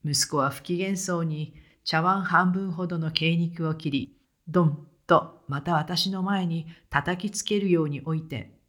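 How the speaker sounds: ordinary delivery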